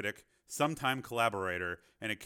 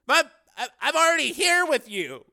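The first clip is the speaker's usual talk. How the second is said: loud, high-pitched protest; 11 dB louder than usual. A narrator's reading. Cameraman protests that he's already along, loud indignant protest. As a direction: stammer the start, then object hotly. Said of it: "Andy protested".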